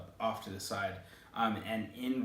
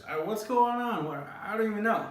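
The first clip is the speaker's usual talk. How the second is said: mock bewilderment; 10 semitones higher, 4 dB louder than usual. Teller overwhelmed by the chaos.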